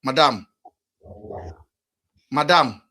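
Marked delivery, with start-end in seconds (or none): none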